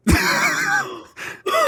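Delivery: airy squeaky laugh; high breathless laugh. Real, not acted. Laughing at the story.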